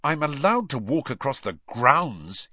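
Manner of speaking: pointed and meaningful. A narrator's reading. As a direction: lay a pointed hint on it. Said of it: "he said pointedly".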